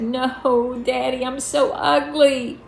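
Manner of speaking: put-on high, plaintive little-girl wail; acted childish misery, drawn out to Daddy; sniffling child. A narrator's reading. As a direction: whine it like a tearful child. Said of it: "I sniffled and said".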